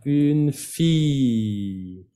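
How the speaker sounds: "Une fille" very slowly, a drawn-out "feee"; long I. Shown as a demonstration. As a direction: stretch the ee of "fille" in "Une fille", falling. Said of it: "como si fuera una I larga".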